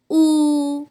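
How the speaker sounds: ऊ held long and level; rounded-lip vowel. Shown as a demonstration. Teacher showing how lips shape this vowel, held rounded ऊ. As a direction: draw ऊ out through rounded lips. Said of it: "lips ऐसे मुंह बन जाता है".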